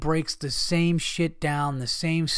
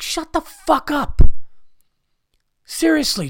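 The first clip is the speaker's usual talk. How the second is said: sudden higher, louder angry snap; angry outburst; 11 semitones higher, 7 dB louder than usual. Real, not acted. Fed up with silly questions, he snaps at reporters angrily. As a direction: blow up at the reporter.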